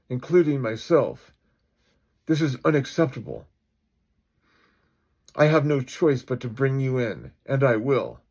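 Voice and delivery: firm, forceful official speech; stern, emphatic chief; indignant police chief. Acted. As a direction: lay down the law.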